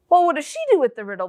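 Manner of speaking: ordinary talk, nothing marked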